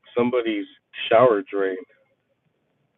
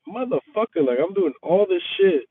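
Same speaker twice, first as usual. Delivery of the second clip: exasperated rant; 7 semitones higher than usual. Retold or imitated. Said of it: "I'm just like".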